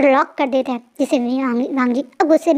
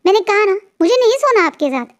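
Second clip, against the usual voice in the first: higher, petulant protest; a cross boy refusing to share the bed, higher and petulant; annoyed sulky refusal; 7 semitones higher, 3 dB louder than usual. A narrator's reading. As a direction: stamp his foot in protest. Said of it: "थोड़ा गुस्सा करते हुए, नखरे करते हुए".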